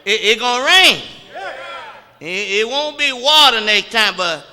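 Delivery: high, loud sermon cadence; full-force preaching. Acted. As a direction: preach it out to the congregation.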